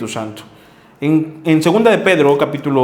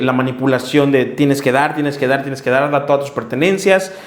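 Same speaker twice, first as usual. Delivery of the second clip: usual speaking voice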